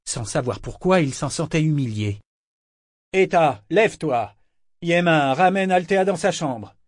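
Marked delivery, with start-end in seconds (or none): 3.1-6.8 raised-voice, urgent orders; commanding. A narrator's reading. Captain orders the fighting women apart, commanding. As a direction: take command and expect obedience. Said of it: "ordonna-t-il".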